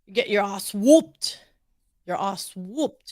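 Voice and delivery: slow mock-British echo; teasing British imitation; mimicked British accent. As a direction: copy a British "ass whooped", drawn out. American mimics British co-host's phrase.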